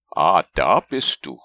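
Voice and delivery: laut nach unten gerufen; lauter Ruf. A narrator's reading. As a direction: ruf erleichtert vom Baum herab. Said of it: "rief Winnie der Pooh". (Pooh ruft Christopher Robin von oben laut zu)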